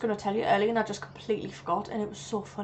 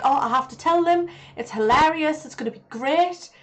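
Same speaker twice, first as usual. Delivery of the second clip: excited self-quote; 6 semitones higher, 5 dB louder than usual. Retold or imitated. Retelling his excited past self.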